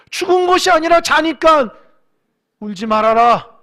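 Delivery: a booming pulpit declaration; loud proclamation. Acted. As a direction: cry out the command to stop weeping. Preacher proclaiming Jesus' words.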